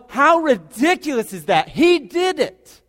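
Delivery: high, loud exclamation, last words stretched; awestruck preaching shout. Real, not acted. Preacher marvelling at God's love, shouting high. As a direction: erupt in amazed proclamation. Sermon climax, marvelling at God.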